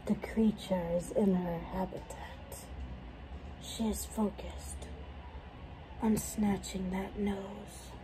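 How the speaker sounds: hushed documentary narrator; nature documentary narrator. Pretend. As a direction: narrate like a wildlife film.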